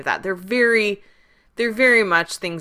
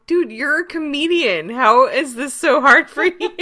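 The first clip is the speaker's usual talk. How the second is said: high, loud, incredulous protest; mock incredulity; 8 semitones higher, 6 dB louder than usual. Viewer chides a comedian who can't keep a straight face, mock incredulous. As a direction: scold in playful disbelief, pitched up.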